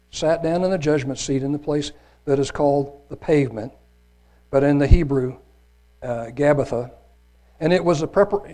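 ordinary talk, nothing marked

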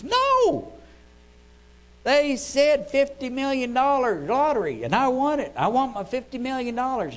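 high, loud outraged demand; shouting indignant lottery winner; angry shout. Acted. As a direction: explode at the clerk like a cheated man. Winner blows up at clerks.

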